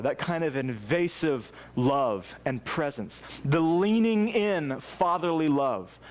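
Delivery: ordinary talk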